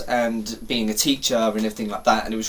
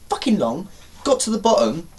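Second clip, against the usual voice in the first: normal talking voice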